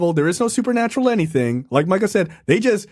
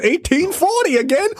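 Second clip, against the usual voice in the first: normal talking voice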